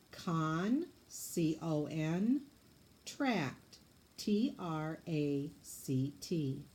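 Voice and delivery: "Con" then C O N, "tract" then T R A C T, spelt slowly; scooping syllables. Shown as a demonstration. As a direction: break "Contract" apart and spell each half letter by letter. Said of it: "let's scoop it".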